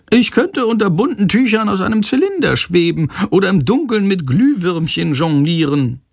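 high, chattery figure voice; munteres Weiterquatschen. A narrator's reading. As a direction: prattle on regardless in a high voice. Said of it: "quatscht einfach weiter". (hoch und unbekümmert drauflosplappern)

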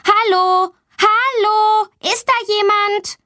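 hoch und laut nach Hilfe rufen; laut rufend. A narrator's reading. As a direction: ruf durch die verschlossene Tür, ob jemand da ist. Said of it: "Sie ruft laut". (Mädchen ruft eingeschlossen um Hilfe, hoch und rufend)

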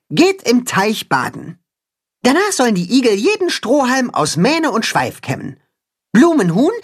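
a lightly voiced cat-hen giving orders; bossy cat-hen. A narrator's reading. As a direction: organise everyone eagerly.

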